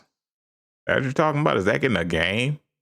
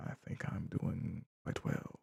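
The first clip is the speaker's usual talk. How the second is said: whispered; 18 dB quieter than usual. Acted. Posh whisper reply.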